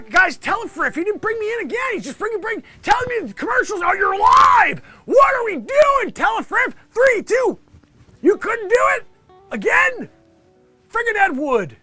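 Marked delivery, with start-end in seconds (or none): 3.8-8.9 high, loud, slow flustered shout; panicked surprise. Acted. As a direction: caught off guard on air. Caught live on air.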